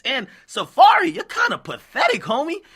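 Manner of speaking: real jeering callout; mocking callout. Real, not acted. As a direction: scoff at him, raising the voice. Calling out Safari.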